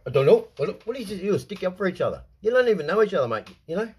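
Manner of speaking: hammed-up outraged rant; mocking angry quote. Mocking how people cover for each other, loud. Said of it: "they go".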